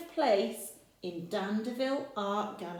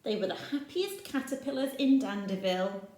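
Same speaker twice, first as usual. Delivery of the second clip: ordinary delivery, nothing marked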